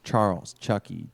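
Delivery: usual speaking voice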